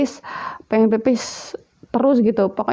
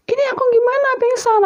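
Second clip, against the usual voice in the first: high-pitched panicked cry for help, retold; acting out alarm at a sudden collapse; 14 semitones higher than usual. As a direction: re-enact a startled, panicky call, pitch leaping up. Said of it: "Aku teriak-teriak panggil anaknya".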